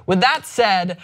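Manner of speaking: ordinary talk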